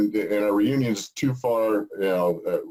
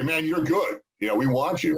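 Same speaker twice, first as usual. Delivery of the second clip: higher-pitched voice of an impressed recruiter; imitated eager praise; 7 semitones higher than usual. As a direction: act out enthusiastic approval of a player. Voicing coaches praising a player.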